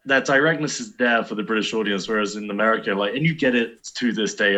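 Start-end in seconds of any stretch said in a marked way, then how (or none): none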